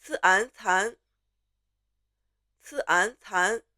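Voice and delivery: "刺安蚕" as consonant, vowel ending, then blended syllable; spelling aloud. Shown as a demonstration. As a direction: model "刺安蚕" piece by piece for repeating. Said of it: "Spell after me".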